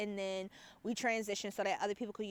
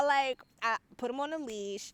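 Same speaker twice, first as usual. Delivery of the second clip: ordinary delivery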